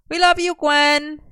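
high, drawn-out shout; loud sing-out holler, pitched high. A narrator's reading. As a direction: call it out loud and slow. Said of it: "Merlin shouted".

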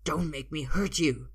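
loud, desperate threat; pressing, desperate menace. A narrator's reading. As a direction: warn him with urgency. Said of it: "he sounds more desperate now".

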